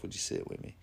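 a soft, voiceless whisper asking shyly; whispered plea. Retold or imitated. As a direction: breathe the question almost without voice. Lonely widow pleading for company.

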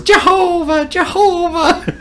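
high sing-song taunt, stretched out; gleeful defiant taunt. Retold or imitated. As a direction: crow it as if dancing about. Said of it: "he starts dancing, goes".

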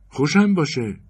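high, loud scornful jab at the teller; sarcastic scoff. Acted. Woman in the bank line scoffing at the teller, high and sarcastic. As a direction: sneer it out, fed up.

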